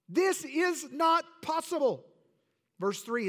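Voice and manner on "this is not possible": higher, louder, slow disbelief; perplexed denial. Acted. Perplexed crowd refusing to believe, slow higher denial. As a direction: spell out the refusal slowly. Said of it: "They were perplexed".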